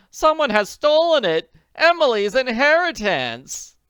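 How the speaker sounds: high, loud despairing cry. Acted. Willoughby mourns stolen inheritance, despair. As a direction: cry out the loss.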